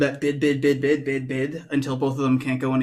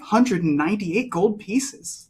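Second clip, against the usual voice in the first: indignant threat; 5 semitones higher, 4 dB louder than usual. Acted. Bea keeps arguing.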